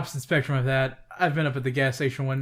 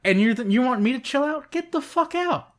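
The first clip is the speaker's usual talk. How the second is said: much higher, pitched-up mock yell; retold angry yell; 10 semitones higher, 3 dB louder than usual. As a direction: throw him out in a high mock shout. Retold yelling at scammer.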